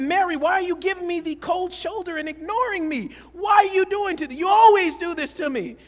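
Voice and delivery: mock-angry complaint to his wife; upset husband. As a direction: rant at the cold shoulder. Complaining wife ignores him.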